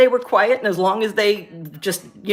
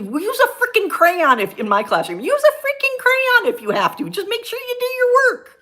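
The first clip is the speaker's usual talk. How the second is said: mock-exasperated classroom rant; exasperated retort; 14 semitones higher than usual. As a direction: fire back in exasperation, pitch jumping up. Acting own exasperated reply to teachers.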